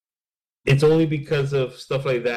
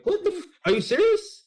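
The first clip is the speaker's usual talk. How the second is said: very high, incredulous acted reaction; high-pitched hammed-up astonishment; mock disbelief. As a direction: play shocked, pitch shooting right up. Acts out disbelief at prank.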